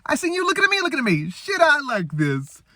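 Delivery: high-pitched, giddy comic amazement; excited high falsetto, drawing out the last words; Eddie Murphy. Retold or imitated. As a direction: squeal it like a hammed-up movie comedian. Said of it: "Eddie Murphy's like".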